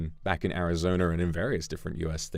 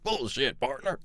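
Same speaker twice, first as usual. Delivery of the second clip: put-on cowboy accent; 7 semitones higher than usual. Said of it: "And Luca kept saying".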